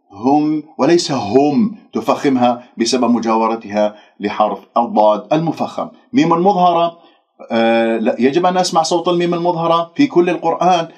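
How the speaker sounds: ordinary talk, nothing marked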